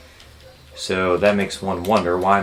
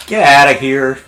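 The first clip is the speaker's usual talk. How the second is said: excited yell; 7 semitones higher, 9 dB louder than usual. Real, not acted. Reacting to game surprise.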